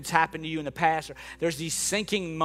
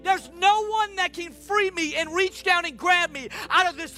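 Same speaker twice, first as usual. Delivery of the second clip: high, forceful plea to Jesus; desperate cry; 13 semitones higher, 4 dB louder than usual. Acted. Peter begging Jesus to save him, desperate cry. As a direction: call out for rescue with everything. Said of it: "he was so desperate".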